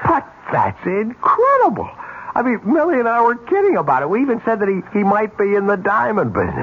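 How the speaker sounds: pitched high with giddy disbelief; can't believe his luck, voice jumping up; excited disbelief. Acted. Husband hearing of a rich cousin, excited disbelief.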